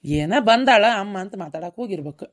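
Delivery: ordinary delivery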